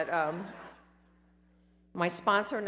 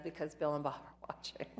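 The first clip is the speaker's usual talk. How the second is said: playful whisper; 7 dB quieter than usual. Acted. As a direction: drop to a giggling whisper. Joking aside about people watching.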